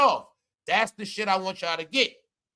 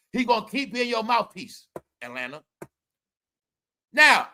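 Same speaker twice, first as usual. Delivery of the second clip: ordinary talk, nothing marked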